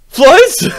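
a loud, high, squealing disbelief; shocked shout. Acted. Caller shocked by news of floods, shrieking loudly.

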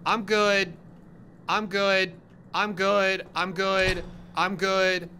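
insistent, high-pitched backing out; nervous refusal. Real, not acted. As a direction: refuse loudly on one repeated high note. Backing off from a scare.

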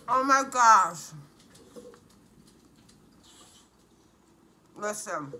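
chewing while talking; talking between bites; mouth full. Real, not acted. Seen 0: cheeks full and jaw working throughout; food looks like sausage, not pancake.

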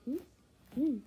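ordinary delivery

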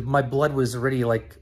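ordinary talk, nothing marked

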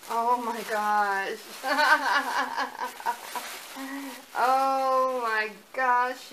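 loud, drawn-out, laughing disbelief; can't believe it, gasping with joy; overwhelmed laugh. Real, not acted. Seeing the gift from the artist, laughing in delight.

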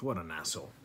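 ordinary talk, nothing marked

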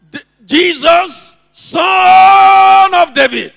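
slow, loud, high cry of Jesus Son of David; retold shout. Blind Bartimaeus crying out over the crowd, loud and drawn out. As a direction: call out like a blind beggar in the crowd. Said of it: "he was shouting".

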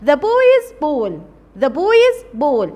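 very slow, word by word; teacher dictating the English sentence very slowly; slow dictation. Shown as a demonstration. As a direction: spell it out slowly for the class.

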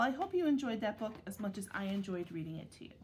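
ordinary delivery, nothing marked

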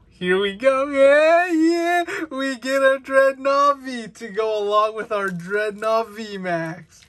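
high, loud thrilled yelling over a good pull; excited cheer. Real, not acted. Collector cheering a great card pull for viewers, excited and high. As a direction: squeal with real delight at the card. Big pull while opening packs.

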